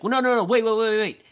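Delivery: imitating a frantic plea to stop; hammed-up panicked wait; panicked pleading.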